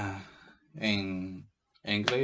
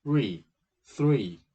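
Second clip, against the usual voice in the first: Three said louder, drawn out; demo word; 7 dB louder than usual. Shown as a demonstration. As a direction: stretch Three to show its sounds. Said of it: "two sounds, one syllable".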